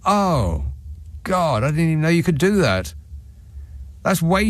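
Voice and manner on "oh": ordinary talk, nothing marked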